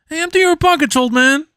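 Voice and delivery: high, fast squeaky thug voice; mock a goofy street robber, way up high; thief voice. Redoing the thief.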